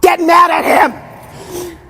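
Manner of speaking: drawn-out, high-pitched shout; shouted command. Real, not acted. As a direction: stretch every word in a yell. Coach yelling at client to get mad.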